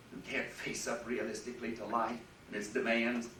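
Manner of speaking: ordinary talk, nothing marked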